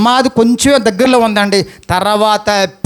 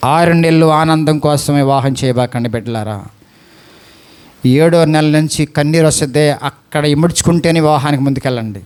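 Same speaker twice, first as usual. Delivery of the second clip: earnest low plea; 7 semitones lower than usual. Real, not acted. Preacher earnestly pleading with listeners.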